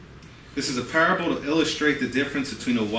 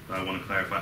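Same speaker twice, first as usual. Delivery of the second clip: normal talking voice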